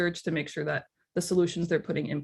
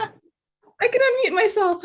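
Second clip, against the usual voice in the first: excited squeal; 5 dB louder, slower than usual. Real, not acted. Finally unmuted on call.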